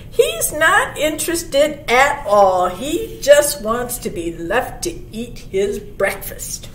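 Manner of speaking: ordinary delivery, nothing marked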